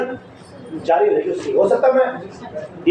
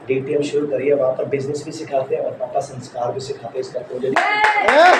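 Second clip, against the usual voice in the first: mocking relative; 6 semitones lower than usual.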